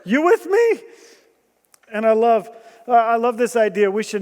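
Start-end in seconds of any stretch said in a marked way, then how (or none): 0.1-0.8 higher, fast, urgent pleading; urgent plea to God. Retold or imitated. Jehoshaphat crying out to God, urgent and high. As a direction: beg God quickly, pitch climbing. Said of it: "stopped everything and said".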